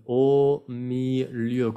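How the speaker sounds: "Au milieu" syllable by syllable, very slow; long O in "Au", rounded eu ending "milieu"; French pronunciation demo. Shown as a demonstration. Teacher shows the letters O and E, "Au milieu" stretched. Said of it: "las letras O y E".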